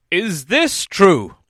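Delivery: very slow, loud question; a doubtful master weighing it; town master. A narrator's reading. Master asks.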